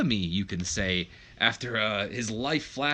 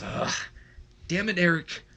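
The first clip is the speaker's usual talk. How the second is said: exasperated groan into a higher curse; mildly acted annoyance, giving in; kinda pissed; 7 semitones higher than usual. Younger brother to older brother, still kinda pissed. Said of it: "kinda pissed still".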